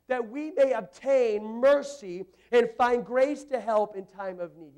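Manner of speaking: loud, high, driving sermon delivery; preaching at full force. Acted. Pastor urging the church to pray boldly, preaching full force. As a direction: pound it out to the whole congregation.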